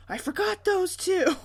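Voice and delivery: hammed-up guilty child; son's sheepish reply. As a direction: play the clueless kid owning up.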